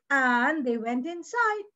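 ordinary delivery, nothing marked

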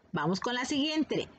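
ordinary talk, nothing marked

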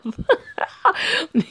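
short high-pitched giggle; high laugh. Acted. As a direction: laugh it off lightly. Character laughs off concern.

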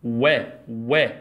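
teacher sounding out و; syllable demo. Shown as a demonstration. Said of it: "نبدأ بيها مقطع جديد".

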